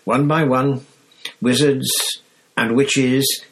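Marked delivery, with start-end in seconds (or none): none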